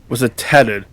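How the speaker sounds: usual speaking voice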